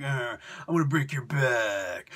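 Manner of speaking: a hint of a brute muttering. Bane taunting Batman's dad, low mock threat. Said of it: "I'm just gonna be like".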